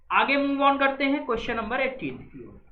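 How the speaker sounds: usual speaking voice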